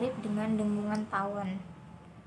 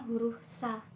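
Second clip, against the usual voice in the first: normal talking voice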